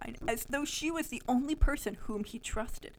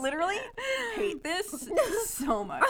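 helpless laughter; 10 semitones higher, 5 dB louder than usual. Real, not acted. Reader hating the story so much, laughing helplessly. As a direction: drag it out through real laughter.

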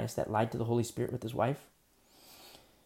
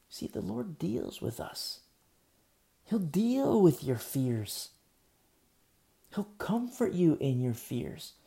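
slow comfort; 4 semitones higher, slower than usual. Real, not acted. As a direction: reassure them unhurriedly. Slow comforting sermon lines.

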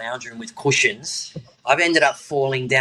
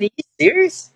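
disbelief; 4 dB louder than usual. Retold or imitated. Disbelief at losing his medal.

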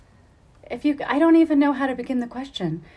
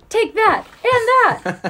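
mock villain taunt; 10 semitones higher, 5 dB louder, much slower than usual. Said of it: "where you have her going".

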